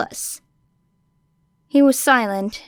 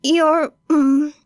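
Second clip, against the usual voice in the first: put-on high, hurt girl voice; fake pained kid; 6 semitones higher, 4 dB louder than usual. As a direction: fake a wince of pain. Faking pain to the hero.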